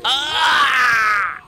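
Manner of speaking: high, loud scream of pain. Acted. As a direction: scream as the gator grabs you. Gator on his back.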